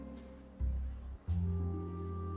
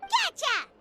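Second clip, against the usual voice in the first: triumphant pounce; 9 semitones higher than usual. Acted. Sneaking up to catch the watcher.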